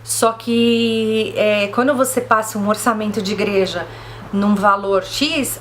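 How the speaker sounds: ordinary talk, nothing marked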